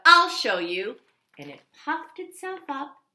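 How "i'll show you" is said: loud huffy threat; loud grumpy ladybug threat. Acted. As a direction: storm off with it.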